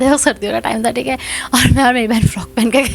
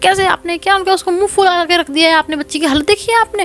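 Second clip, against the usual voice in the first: stern principal scolding, pitched higher; retold scolding; 8 semitones higher than usual. Principal scolds the teacher for hitting a child, sternly and higher. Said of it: "फिर sir ने डांटा कि".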